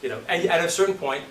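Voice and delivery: ordinary delivery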